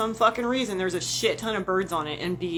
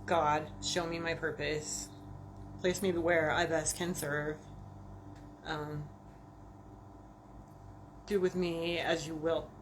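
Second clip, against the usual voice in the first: morning prayer; 2 semitones lower, 6 dB quieter than usual. Retold or imitated. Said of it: "every morning and I say".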